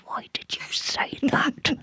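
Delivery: hushed self-scolding whisper, slowly; inner whisper. Retold or imitated. Locked in the loo, whispering at herself. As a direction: whisper the regret to yourself. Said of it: "and thinking".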